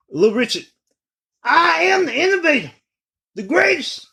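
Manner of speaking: very high falsetto proclamation. Acted. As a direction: shriek it up high like a showman. Imitating [name]'s high proclamation.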